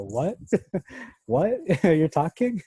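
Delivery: high, laughing What? What?; mock startled disbelief. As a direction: act shocked that an animal talks, laughing. Startled reply to talking animal.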